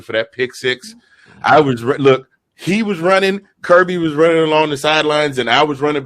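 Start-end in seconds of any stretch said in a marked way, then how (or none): none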